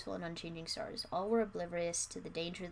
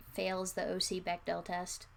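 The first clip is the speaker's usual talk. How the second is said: ordinary delivery, nothing marked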